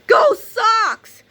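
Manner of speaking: high far-off shout of triumph; villain battle cry. Acted.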